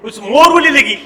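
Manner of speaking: normal talking voice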